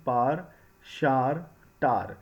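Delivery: पार, शार, टार each as one long syllable; one-syllable pronunciations. Shown as a demonstration. Teacher recaps the three words, पार, शार, टार as single syllables. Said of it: "इसे हम टार pronounce करते हैं".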